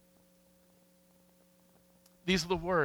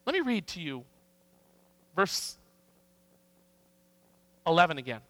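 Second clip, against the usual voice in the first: ordinary delivery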